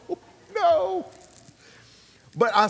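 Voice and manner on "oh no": very high, comic wail of disappointment; hammed-up high-pitched dismay; mock dismay. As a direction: play up the letdown in a squeaky cry. Reacting to the cancelled trip.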